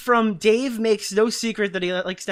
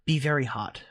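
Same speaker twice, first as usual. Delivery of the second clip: lightly voiced mouthy Buddha; slow, low, insolent mock-sage read; 7 semitones lower, 6 dB quieter, slower than usual. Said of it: "read this in a mouthy Buddha voice".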